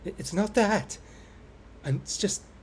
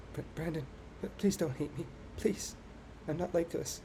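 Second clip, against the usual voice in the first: soft, stammering plea, much softer; pleading quiet; 4 semitones higher, 9 dB quieter than usual. Acted. Yuri begging Brandon not to hate her, soft and stammering.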